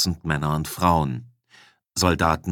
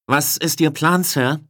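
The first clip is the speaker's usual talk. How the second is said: higher-pitched, eager soldier addressing a superior; raised, higher voice of a subordinate; soldier's question; 8 semitones higher, 3 dB louder than usual. A narrator's reading. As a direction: ask the commander briskly for orders. Soldier calls question to her commander.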